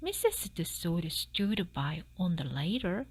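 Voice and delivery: usual speaking voice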